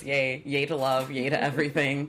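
low, lightly mocking yay; low mock cheer. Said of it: "Katie says".

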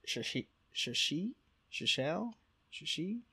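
trying Shashi and Shishel as questions; tentative, rising attempts at Shashi and Shishel; unsure pronunciation. Real, not acted. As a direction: sound out Shashi haltingly, guessing. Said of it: "I don't know how to say this".

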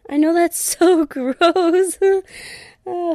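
slow, laughing through the words. Real, not acted. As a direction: breaks into a high laugh. Laughs at her own sniffles.

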